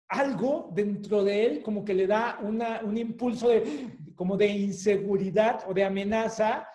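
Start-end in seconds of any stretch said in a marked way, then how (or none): none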